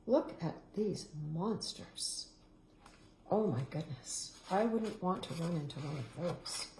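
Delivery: soft, low mock-worried murmur; hushed aside. Reader shows the children the pictures in a soft, low murmur. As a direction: lean in and share the little fright, quietly.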